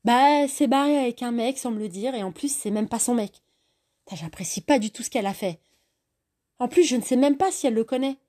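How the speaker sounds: annoyed rant. Acted. Angry rant about friend.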